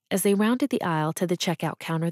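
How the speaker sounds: ordinary delivery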